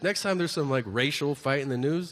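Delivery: normal talking voice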